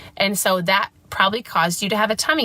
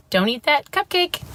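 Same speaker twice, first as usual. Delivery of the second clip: higher, scolding mock mom at the party; "cupcake" said with a nagging rise, playing the strict parent; 6 semitones higher than usual. Mom mimicking the strict parent she refuses to be, lightly scolding. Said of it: "I never, ever, ever say".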